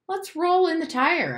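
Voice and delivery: put-on high-pitched, eager child's pitch; high, keen kid voice; eager suggestion. A narrator's reading. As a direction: suggest a game brightly and high.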